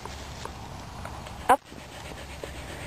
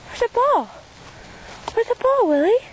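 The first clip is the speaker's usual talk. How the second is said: high dog-talk voice; 9 semitones higher than usual. Real, not acted. Talking to the dog.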